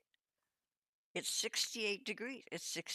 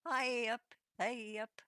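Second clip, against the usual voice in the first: loud, higher mock British "hey up"; bad British accent; 6 semitones higher, 5 dB louder than usual. Southern Californian practising another streamer's catchphrase, bad British accent. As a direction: try out a British greeting, not quite convincingly. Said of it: "doing a bad British accent".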